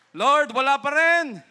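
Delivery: high, very loud call; crying out to God. Acted. Abraham tells the Lord none are found, loud high cry. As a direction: shout it up to heaven.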